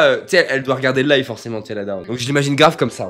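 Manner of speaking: ordinary talk, nothing marked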